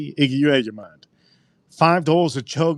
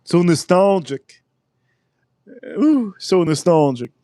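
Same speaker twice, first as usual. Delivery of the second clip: mock nostalgia; 5 semitones higher, slower than usual. Mock sentimental sigh.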